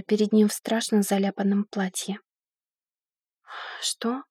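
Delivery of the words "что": soft, breathed-out question; barely voiced, rising question; exhaled. A narrator's reading. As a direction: exhale the word in a daze. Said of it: "снова выдохнула я".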